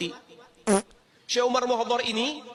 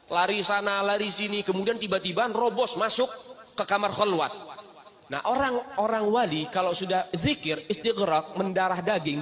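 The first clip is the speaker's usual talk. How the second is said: usual speaking voice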